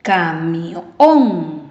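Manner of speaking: "Camión" drawn out, swelling on ÓN; stress on last syllable. Shown as a demonstration. Teacher shows words ending in N, "Camión" stressed on ÓN. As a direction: start "Camión" low, hit a long ÓN. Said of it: "¿Escuchas la fuerza de voz?".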